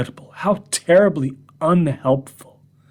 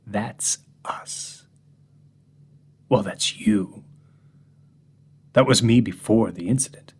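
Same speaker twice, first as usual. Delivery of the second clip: ordinary talk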